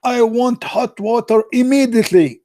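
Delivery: high, slow commanding husband voice; hammed-up bossy husband calling out; mock shouting husband. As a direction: bark orders at the wife. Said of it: "he shouted to his wife".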